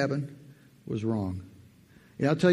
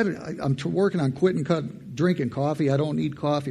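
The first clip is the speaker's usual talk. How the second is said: ordinary talk, nothing marked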